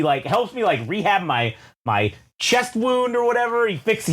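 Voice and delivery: ordinary delivery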